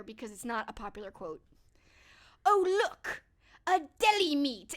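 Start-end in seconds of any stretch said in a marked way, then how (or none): 2.4-4.7 quoting Heavyweights. Retold or imitated. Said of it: "the pause and the way he says it".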